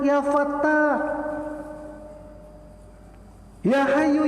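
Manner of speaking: high, fervent sermon voice; impassioned preaching. Acted. Preacher calling out God's names to the congregation, fervent. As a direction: preach it with rising devotion.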